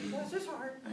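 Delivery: high, sweet, mock-pitying; gossipy neighbor voice. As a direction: sigh it like a nosy neighbor.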